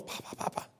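put-on breathy little-girl call for grandpa; whispered toddler call. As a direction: whisper "Pop, Pop, Pop, Pop" like a tiny girl calling. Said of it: "that little girl goes".

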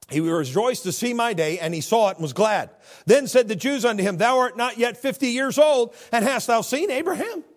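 high, loud preaching voice; fiery sermon delivery; full-force preaching. Acted. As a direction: preach it out over the congregation. Preacher reading scripture fervently.

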